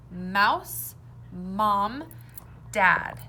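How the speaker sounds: loud, slow word list; sounds clearly shown. Shown as a demonstration. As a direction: say each word clearly for the class. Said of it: "Which word begins with a different sound?".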